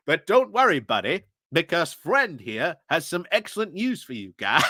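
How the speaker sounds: mock Canadian accent with bouncing pitch; terrible Canadian accent. British commentator teasing Canada with good news, bad Canadian accent. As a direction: do a badly faked, cartoonish Canadian accent. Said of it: "That's terrible".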